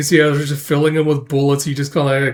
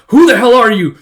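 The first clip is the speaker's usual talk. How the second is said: loud, high mock-guard yell; alarmed challenge; 9 semitones higher, 10 dB louder than usual. Guard confronting an intruder, alarmed yell. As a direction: challenge an intruder at the top of your voice.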